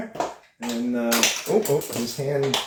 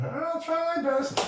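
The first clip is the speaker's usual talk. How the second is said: high-pitched frog puppet chatter; squeaky puppet greeting his cousin; 13 semitones higher, 5 dB quieter than usual. Acted. Puppeteer voices Kermit to host, high puppet voice.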